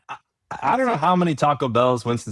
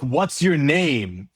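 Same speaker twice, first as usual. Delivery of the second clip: a drawn-out, slow, impatient repeat; slow repeated question; 4 semitones higher, much slower than usual. Retold or imitated. Quoting the annoyed worker repeating the question slowly. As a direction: repeat it slowly as if to someone who didn't hear. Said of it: "They're like".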